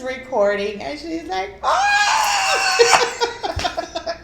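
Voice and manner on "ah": long, very high shriek; hammed-up scream of surprise; mock startled scream. Mom opens door to daughter, imitated surprised scream.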